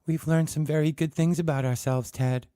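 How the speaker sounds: coldly. Acted. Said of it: "Susan said coldly".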